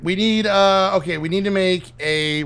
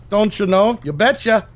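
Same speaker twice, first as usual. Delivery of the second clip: hammed-up Canadian accent, higher; jokey higher Canadian accent; mock Canadian accent; 6 semitones higher, 4 dB louder than usual. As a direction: play up the Canadian accent for a laugh.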